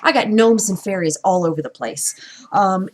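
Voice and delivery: usual speaking voice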